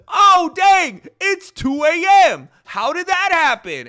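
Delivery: very loud, high, stretched-out dismay; mock panic yell. Streamer acting out losing track of time until two, mock panicked yell. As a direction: cry out high in exaggerated alarm.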